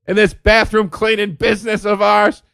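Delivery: high mock maid shouting her grievance; griping maid. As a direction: rant like a disgruntled maid. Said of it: "There's no maids walking around going".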